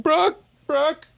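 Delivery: high calling out; mock call. Imitating the crewman calling his friend, mock call. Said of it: "that guy was the one that's like".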